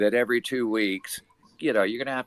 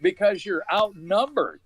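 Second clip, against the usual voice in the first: higher, mock-tough telling off; retold angry warning; 10 semitones higher than usual. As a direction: warn them off, half acted. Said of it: "And I said".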